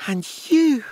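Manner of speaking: high, scornful spat accusation. A narrator's reading. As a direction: spit it at him. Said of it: "Claudia spat".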